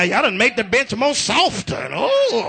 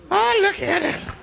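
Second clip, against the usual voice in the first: slow, high parental cooing; re-enacted proud parent's marvelling; doting admiration; 8 semitones higher, slower than usual. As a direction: admire the baby in a high, drawn-out voice. Father admiring baby eating.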